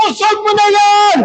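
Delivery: high, forceful repeat to the crowd; rallying shout. Real, not acted. Preacher making the congregation repeat, shouted. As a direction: shout it out high for them to echo. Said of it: "Ulitin mo".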